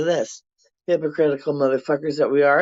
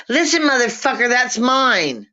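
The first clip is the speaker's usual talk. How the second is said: put-on high, quick bossy little kid; kindergarten kid; 9 semitones higher, 3 dB louder than usual. Vlogger imitating daycare kids fighting over a toy, high and fast. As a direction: play a tiny kid who swears to claim a toy. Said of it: "kindergarten age kids going".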